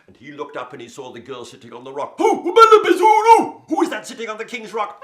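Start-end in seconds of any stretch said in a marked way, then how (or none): 2.2-5.0 very high, loud, slow bellow; a startled buffalo bellowing. Acted. Buffalo voiced in story.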